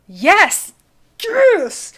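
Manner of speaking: loud, high cheer; squealed yes at the good answer; excited yes. Real, not acted. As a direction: cheer it out, thrilled. Reacting to sun saying yes.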